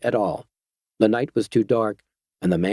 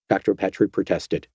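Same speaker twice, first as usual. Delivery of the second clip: ordinary delivery, nothing marked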